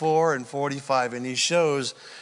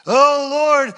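fervent cry; 9 semitones higher, 8 dB louder than usual. Retold or imitated. Israel crying out.

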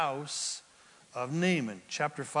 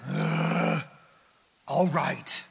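grudging; much slower than usual. Acted.

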